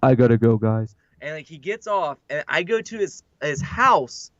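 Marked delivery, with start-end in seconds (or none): 0.0-0.9 low, loud, deadpan announcement; curt mock sign-off. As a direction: imitate a fuming friend bowing out. Retelling angry friend signing off.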